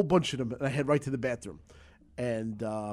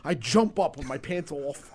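usual speaking voice

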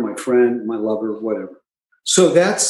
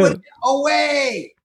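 mock firm vow; 9 semitones higher, slower than usual. Voicing a normal drinker's resolve.

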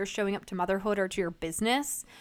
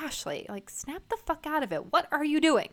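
exasperated self-reprimand, higher on the key words; scolding herself; 6 semitones higher than usual. Acted. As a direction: snap yourself out of it. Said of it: "visualize myself in the room I'm in being crazy and be like".

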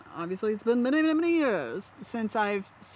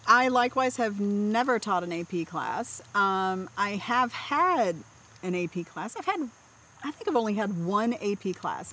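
normal talking voice

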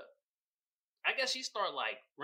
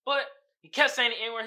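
normal talking voice

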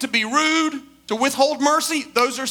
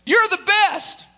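high, projected call across a cliff; raised storytelling mock shout; 7 semitones higher, slower than usual. Dad calls out to show echo.

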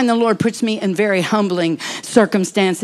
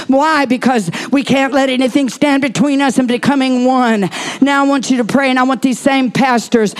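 raised, louder preaching voice; 5 semitones higher, 4 dB louder than usual. Acted. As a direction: call the congregation up with fervor. Pastor calls people forward.

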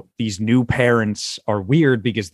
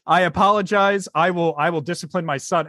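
apologetic dad; 6 semitones higher than usual. Retold or imitated. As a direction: play an earnest apology for laughs. Retells joke, dad apologizing to stranger.

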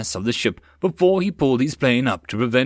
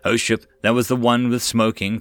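ordinary delivery

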